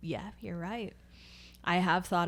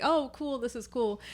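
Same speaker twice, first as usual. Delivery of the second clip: mildly excited, higher-pitched mimicry; imitated excitement; 6 semitones higher than usual. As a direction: act out a pleasantly impressed server. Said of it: "they get really excited".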